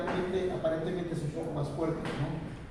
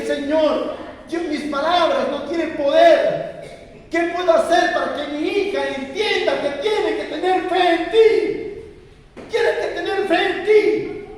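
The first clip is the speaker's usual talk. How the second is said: high, loud, slow anguished prayer; tearful clamor; 9 semitones higher, 9 dB louder, slower than usual. Retold or imitated. Father on his knees crying out to God, tearful loud clamor. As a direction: cry out to God through tears. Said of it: "con lágrimas en los ojos y con clamor".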